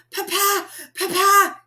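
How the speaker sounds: high, loud breathless calls to father; out of breath from running; panting. A narrator's reading. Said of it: "he panted".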